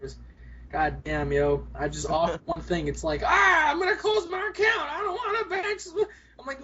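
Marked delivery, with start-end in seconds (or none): 3.2-5.8 high, louder whiny complaint; whiny customer. Retold or imitated. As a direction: mimic a fussy client closing his account, high. Customer quitting bank, mocked.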